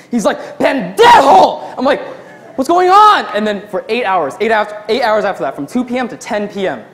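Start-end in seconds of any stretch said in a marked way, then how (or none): 0.6-1.8 loud, high, drawn-out curse; imitating a coworker's screamed insult; screaming. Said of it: "he's screaming at the ticket receipt machine". 2.6-3.2 high, loud bewildered shout; acting out alarmed confusion; panicked yell. Panicked reply amid kitchen chaos.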